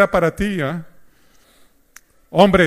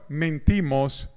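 ordinary talk, nothing marked

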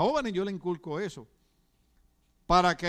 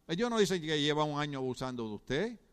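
usual speaking voice

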